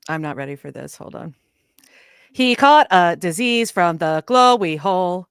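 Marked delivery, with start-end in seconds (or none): 2.3-5.3 slow, loud, measured robot voice. Retold or imitated. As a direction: spell it out like a computer announcement. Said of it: "I can imitate that exact robot voice".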